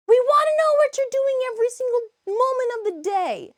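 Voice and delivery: high, loud mock cat voice; voicing the cats. Streamer voicing the nosy cats in a high, loud voice. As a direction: put words in the cats' mouths, high and loud.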